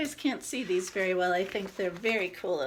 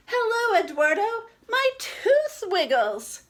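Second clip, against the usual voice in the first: put-on excited little girl; 13 semitones higher, 9 dB louder than usual. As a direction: chirp it out as an excited kid. Girl character greets shopkeeper.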